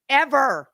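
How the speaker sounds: "ever" hit loud and high for emphasis; stress on ever. Real, not acted. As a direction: lean hard on "ever".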